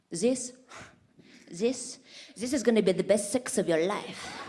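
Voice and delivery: put-on boastful Frenchman with a thick French accent; French-accented lover's big promise. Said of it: "and he's like".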